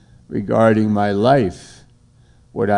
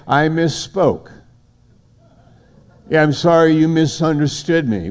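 mock hypocrite excuse; 6 semitones higher than usual. Preacher voices a hypocrite excusing himself.

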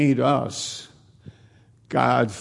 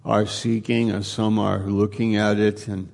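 prayer; 3 semitones lower than usual. Acted. Pastor leading the congregation in closing prayer, hushed and reverent. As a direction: pray aloud, gently and unhurried.

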